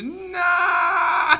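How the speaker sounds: long, high mock wail; imitated scream. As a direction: copy the comedian's big drawn-out cry. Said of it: "I love that you did the".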